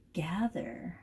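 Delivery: whispered vocab word. Shown as a demonstration. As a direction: breathe the word softly for them. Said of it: "Now, gather means".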